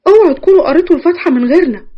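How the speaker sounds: high, excited burst of joy; happy. A narrator's reading. Women bursting in on the engagement talk, happy and excited. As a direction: call it out gladly, pitched well up. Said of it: "وفرحانين وبيقولوا".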